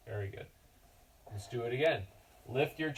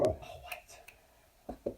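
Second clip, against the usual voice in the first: whispered what; 7 dB quieter than usual. Real, not acted. Whispered reaction.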